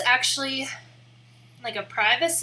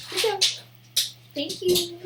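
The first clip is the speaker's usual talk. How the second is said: high, cooing praise for the dog; pet praise voice; 7 semitones higher than usual. Real, not acted. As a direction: sweet-talk the puppy.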